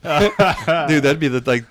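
loud, high burst through laughter; delighted, laughing outburst. Real, not acted. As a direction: crack up while talking. Cracking up at the joke.

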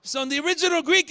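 ordinary delivery

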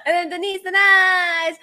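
high, drawn-out name announcement; playful tagline introduction. Pretend. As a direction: announce it high and sing-song, ending loud.

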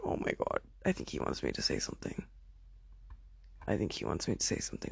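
low, soft, barely voiced; hushed realization. Realizing he expects a reply, hushed. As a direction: retell it under your breath. Retells dawning worry to friend.

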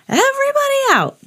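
very high lifeguard shout repeated. A narrator's reading. Lifeguard ordering swimmers out, shouting. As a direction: call it out across the beach. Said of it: "he shouted".

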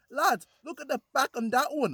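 high mock voice of a little kid; imitating a kid. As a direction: play the cheeky six-year-old shouting. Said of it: "six-year-old guy just cycles past and says".

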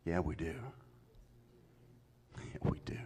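low, soft, dry; quiet knowing reply. Acted. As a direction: drop it in quietly. Quiet answer after shouting.

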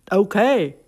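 hammed-up, annoyed Texan; Texas accent. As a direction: throw the insult back in a Texas accent. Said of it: "do a better Texas accent".

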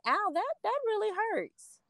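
high, soft pained quote; a touch of hurt, high and soft; retold pain. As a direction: say it high and hushed. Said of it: "and I was like".

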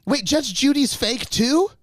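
high, drawn-out startled question; real disbelief. Real, not acted. Listener learning the TV court is staged, disbelieving.

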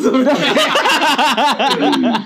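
big burst of laughter; loud laugh. Real, not acted.

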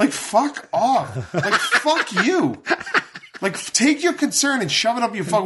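high, slow, laughing fuck-off at followers; laughing rant. Acted. Ranting at presumptuous followers, laughing and mocking.

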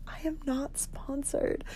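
hiss-free hushed denial, drawn out; embarrassed aside. Retold or imitated. Embarrassed aside to her dad.

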